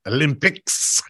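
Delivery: "Olympics" drawn out in a mimicked odd pronunciation; mocking imitation. Cohost questioning how he says it, mockingly imitating "Olympics". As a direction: tease with an exaggerated echo of "Olympics". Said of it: "Why do you say it like that?".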